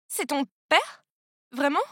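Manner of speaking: mild halting disbelief; stammering. A narrator's reading. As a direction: break it up with a hesitant gap. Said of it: "J'ai bégayé". (léger bégaiement hésitant)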